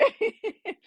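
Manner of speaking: high giggle trailing off; short laugh. Real, not acted. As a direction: laugh it away. Laughs after joking with viewer.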